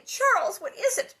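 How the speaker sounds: high, loud startled question; urgent alarm to a husband; alarmed cry. A narrator's reading. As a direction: cry out as if jolted awake. Ma startled awake at night.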